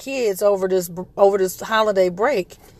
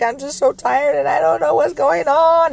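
put-on higher, whiny little-girl voice, lightly done; imitating a crying child; 7 semitones higher than usual. Seven-year-old crying to mom, mock weepy. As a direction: mimic her tired, teary daughter. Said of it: "she was crying and she's just like".

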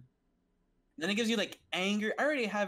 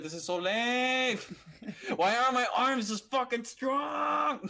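retold frustrated shout, drawn out; annoyed mock yell; 6 semitones higher, 5 dB louder, much slower than usual. Lifter retells cursing their own arms at a failed lift, annoyed. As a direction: re-enact a loud, higher-pitched, slow outburst. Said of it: "I was so fucking annoyed".

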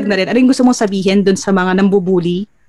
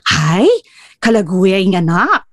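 "Hi, kalaguya yung anak" with a lightly blunt Kapampangan lilt; right Kapampangan tone; 2 semitones lower, slower than usual. Shown as a demonstration. (tamang tono ng Kapampangan)

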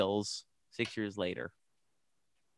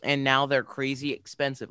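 usual speaking voice

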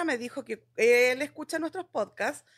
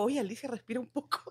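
self-scolding aside; 5 semitones lower, 8 dB quieter than usual. Retold or imitated. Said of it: "yo he escuchado los podcasts míos y digo".

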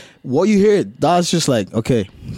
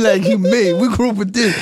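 mock voice; 9 semitones higher, 3 dB louder than usual. Mocking brand changing recipe.

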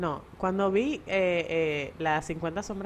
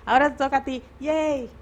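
bright, high cheer of handing over; excited; 8 semitones higher, 5 dB louder than usual. Real, not acted.

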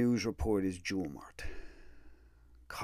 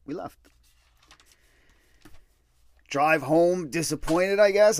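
higher, louder, sarcastic "disappointed"; mock disappointment; 9 semitones higher, 12 dB louder than usual. Real, not acted. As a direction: scoff at the idea of being let down. Mocking own disappointment.

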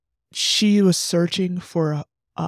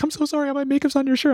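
high, teary apologetic voice, mimicked; tearful girl's apology; 9 semitones higher, much faster than usual. Teller voices the sobbing girl apologizing about makeup, high and teary. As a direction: imitate the crying girl saying sorry.

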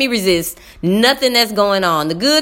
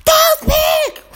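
high-pitched mock kid shout; mimicked schoolboy holler; imitating yelling student. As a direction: yell the repeat like an overexcited pupil. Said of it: "walking around the room yelling".

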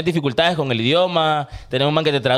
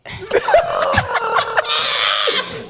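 real laughter. Real, not acted. Laughing at his own story.

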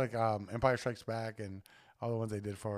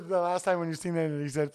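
ordinary talk

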